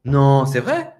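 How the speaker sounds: loud, surprised Non, c'est vrai?; a little astonished. Shown as a demonstration. Teacher acting a stunned listener hearing gossip from the sister, astonished. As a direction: react like you can't quite believe it. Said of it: "avec un air un petit peu étonné".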